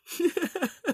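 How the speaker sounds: bright high-pitched laugh. Real, not acted. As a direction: burst into a squeaky laugh. Laughs after own joke.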